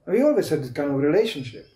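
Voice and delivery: usual speaking voice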